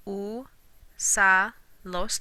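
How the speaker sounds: "usalos" stretched very slowly syllable by syllable; "usalos" pulled apart slowly, us-a-los; drawn-out syllables. Shown as a demonstration. Teacher stretches "usalos" so students count syllables. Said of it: "you have to have an accent".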